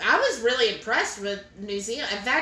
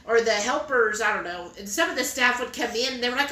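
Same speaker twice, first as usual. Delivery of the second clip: ordinary talk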